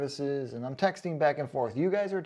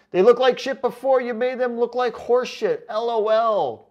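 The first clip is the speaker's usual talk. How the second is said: louder, higher sneering read of the comment; mocking loud readout; 7 semitones higher, 8 dB louder than usual. Reading a hater's comment aloud, loud and mocking. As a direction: throw the insult back mockingly.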